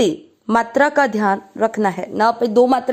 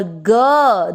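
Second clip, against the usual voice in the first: ग stretched slowly so the a sounds; drawn-out ga; 2 semitones lower than usual. Shown as a demonstration. Teacher stretching ग to show the added a sound. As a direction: hold the ग until the vowel comes out. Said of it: "जब अपन थोड़ा सा उसको खींचते हैं".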